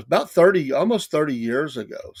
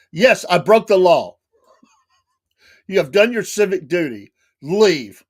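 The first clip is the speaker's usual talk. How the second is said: louder, lordly and sarcastic; mock grand dismissal; 4 semitones higher, 7 dB louder than usual. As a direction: dismiss him like a pompous lord.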